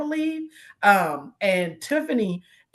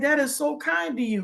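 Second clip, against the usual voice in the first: retold tender reply; 8 semitones higher than usual. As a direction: thank a little boy gently, pitched up.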